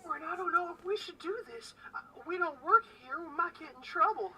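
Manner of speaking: high, loud fretful whisper-tinged plea; nervous innkeeper voice. Acted. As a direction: worry like a timid aunt. Innkeeper worries behind the bar.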